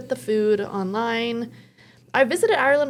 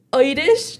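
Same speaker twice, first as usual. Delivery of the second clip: Irish said with a brief, hammed-up Irish accent; Irish accent attempt; 3 semitones higher, 4 dB louder than usual. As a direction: try a quick mock Irish lilt on Irish. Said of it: "trying to have an Irish accent".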